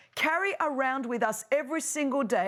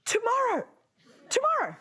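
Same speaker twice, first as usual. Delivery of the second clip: lazy mock Pharaoh; 8 semitones higher than usual. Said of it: "And then Pharaoh goes".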